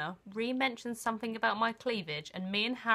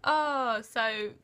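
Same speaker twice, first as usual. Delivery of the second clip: normal talking voice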